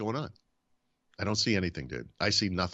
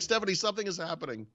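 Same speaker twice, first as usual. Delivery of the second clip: high-pitched insistent quote; retold urgency; 10 semitones higher than usual. Husband alerting his wife mid-flight, retold urgency. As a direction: re-enact pressing an oblivious partner.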